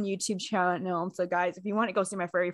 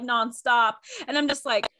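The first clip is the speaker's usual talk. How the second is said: ordinary delivery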